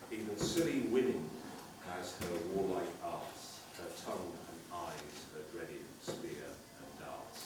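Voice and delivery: solemn Burns recitation; poem recitation. Acted. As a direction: recite it gravely. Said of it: "Burns penned the words".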